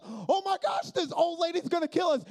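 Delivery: put-on jumpy pastor fearing an old lady, high-pitched; hammed-up panic. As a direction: overreact in fear.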